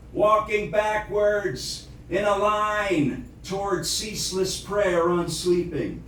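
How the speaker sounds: incantatory poem reading, measured phrases with deliberate pauses; poetry recitation. Acted. Recites own poem after plain chat.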